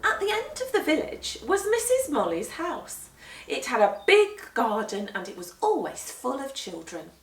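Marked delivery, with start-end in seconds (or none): none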